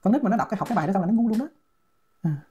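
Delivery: ordinary delivery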